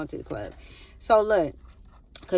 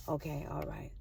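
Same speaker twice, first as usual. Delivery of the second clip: very quiet, low acceptance; retold resignation; 5 semitones lower, 15 dB quieter than usual. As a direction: say it small, to yourself. Retold resigned reaction to self.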